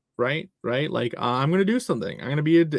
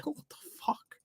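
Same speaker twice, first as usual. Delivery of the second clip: whisper; 11 semitones higher, 15 dB quieter than usual. Real, not acted. Whispered reaction.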